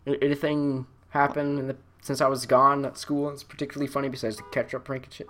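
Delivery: ordinary talk, nothing marked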